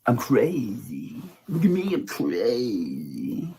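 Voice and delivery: slow, drawn-out fake-crazy voice; mocking Patty. Mocking Patty pretending to be crazy, slow and drawn out. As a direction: stretch it out like someone faking insanity.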